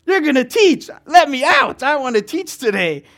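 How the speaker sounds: eager spirit. Retold or imitated. His spirit demanding to teach, a high loud eager burst. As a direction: let it burst out like something trapped inside. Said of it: "it was saying".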